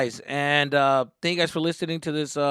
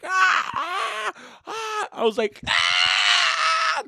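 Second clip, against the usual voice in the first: mock screams; much slower than usual. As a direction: imitate the frozen screams in the photo. Everyone screaming in photo.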